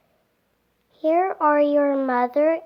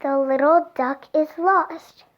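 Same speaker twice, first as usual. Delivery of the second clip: ordinary delivery, nothing marked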